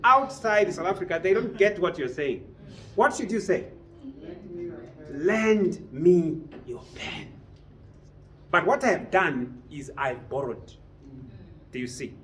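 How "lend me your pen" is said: very slow, word by word; slow model phrase. Shown as a demonstration. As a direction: spell it out slowly for the class. Teacher models the correct phrase.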